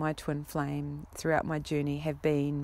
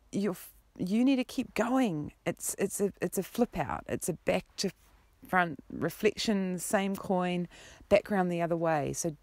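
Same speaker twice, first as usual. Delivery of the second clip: ordinary delivery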